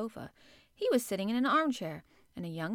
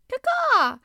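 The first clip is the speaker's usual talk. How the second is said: high, loud raspy caw; hoarse crow caw; 13 dB louder than usual. A narrator's reading. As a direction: croak it like a crow. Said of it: "remarked the crow hoarsely".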